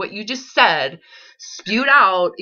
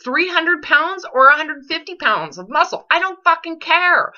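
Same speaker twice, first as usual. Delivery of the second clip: exasperated outburst; 7 semitones higher than usual. Real, not acted. Coach confronting her client, exasperated and high-pitched. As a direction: pitch up, pushing hard in frustration.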